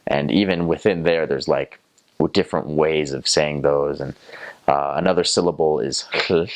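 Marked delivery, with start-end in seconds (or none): none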